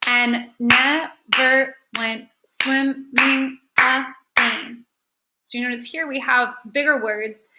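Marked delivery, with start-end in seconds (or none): 0.1-4.9 very slow, each syllable set apart; deliberate syllable-by-syllable reading; clapped-out syllables. Shown as a demonstration. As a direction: clap out every syllable as you read. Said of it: "clap out as we read the different syllables".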